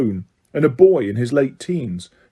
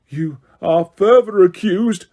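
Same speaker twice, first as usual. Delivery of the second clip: higher, louder and slow; bellowing; 6 semitones higher, 3 dB louder than usual. A narrator's reading. Crouch to the accused, slow bellow. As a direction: bellow the charge over the room. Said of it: "bellowed Mister Crouch".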